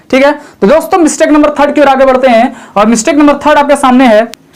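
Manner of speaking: ordinary talk, nothing marked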